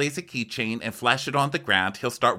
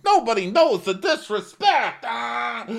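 higher, louder indignant yell; mock outraged shout; 5 dB louder, slower than usual. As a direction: yell about the disrespect, hammed up. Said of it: "Joe's walking around going".

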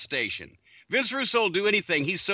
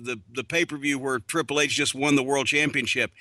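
ordinary talk, nothing marked

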